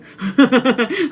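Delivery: high burst of laughter; loud laugh. Real, not acted. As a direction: laugh out loud at the awkward moment. Laughing at the women's reaction.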